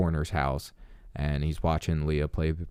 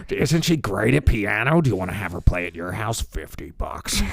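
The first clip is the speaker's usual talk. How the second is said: sleazy salesman voice; 2 semitones higher than usual. Retold or imitated. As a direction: hawk her like a street seller. Voicing the brother selling sister.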